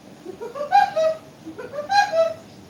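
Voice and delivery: very high rat-a-tat cartoon cackle; cartoon woodpecker laugh, high; Woody Woodpecker laugh. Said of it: "That's my Woody Woodpecker impression".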